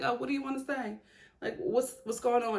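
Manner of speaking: a hint of calm Paige asking; mock Paige. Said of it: "she was just like".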